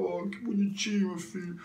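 high, lightly mocking coo; teasing mother. Mother teasing her bandaged son, high mocking coo. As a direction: imitate a mother poking fun with baby talk. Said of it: "Minha mãe até zoava".

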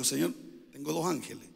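a soft, hushed aside to the Lord, a little quick; remembered prayer said softly to himself. Retold or imitated. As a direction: murmur the prayer under your breath. Retold quiet prayer in hardship.

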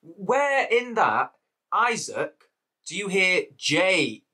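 drawn-out, incredulous questioning; exasperated disbelief. Real, not acted. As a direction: spell out your disbelief word by word. Exasperated at being misheard.

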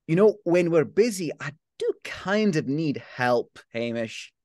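a tired aunt scolding him a little; aunt chiding a sulking teenage nephew; Muriel the pub landlady. Acted.

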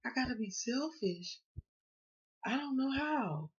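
high, soft, puzzled imitation; mock confused Cancer. Reader mimicking a confused Cancer, high and soft. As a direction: play the clueless sign, small and lost. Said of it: "Cancer like".

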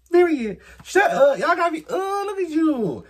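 high squealy laughing teasing; squealing tease. Real, not acted. Teasing the viewer who asked, high flustered squeal.

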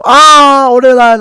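high, loud shriek then shouted question; real fright; startled scream. Real, not acted. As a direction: panic at the thing behind him. Monster appears behind him.

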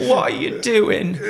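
high, loud, slow mimicked woman; woman's calling voice. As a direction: draw the question out high and loud. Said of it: "She was like".

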